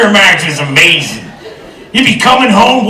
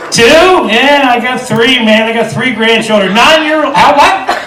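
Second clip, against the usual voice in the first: ordinary talk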